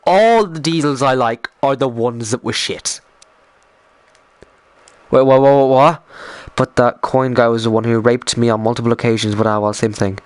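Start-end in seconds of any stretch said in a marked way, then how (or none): 5.1-6.0 loud startled what what; stunned repeated what; shocked. Real, not acted. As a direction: blurt it out in disbelief. Shocked reaction to chat.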